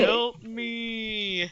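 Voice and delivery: a whimpering, pitiful little zombie cry; sad zombie voice. Retold or imitated. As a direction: beg like a sad dying zombie. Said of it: "'cause they say".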